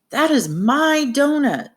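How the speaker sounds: insistent, high-pitched exclamation; adamant. Acted. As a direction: exclaim it like you really insist. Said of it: "if Clem was really adamant".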